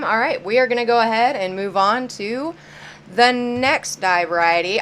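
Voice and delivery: ordinary talk, nothing marked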